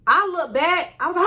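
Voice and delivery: normal talking voice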